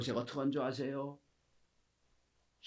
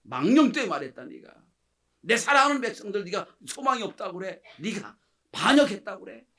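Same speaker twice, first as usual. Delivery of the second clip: higher, louder indignant scolding; louder, higher protest in God's voice; God's hurt rebuke; 6 semitones higher, 9 dB louder than usual. Acted. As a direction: rebuke them like a wounded father. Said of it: "하나님 평가합니다".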